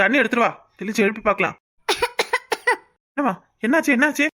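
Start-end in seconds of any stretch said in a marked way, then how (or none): 1.9-2.8 high, strained little cough; faint cough on waking; weak cough. Acted. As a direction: cough as she comes to. Fainted girl comes round.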